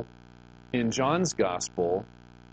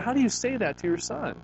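ordinary delivery

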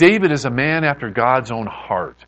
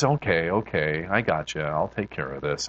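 soft, low, calming voice; acted calm acceptance; gentle reassurance; 8 semitones lower, 7 dB quieter than usual. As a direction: soothe patiently, dropping low and quiet. God gently reassuring Moses.

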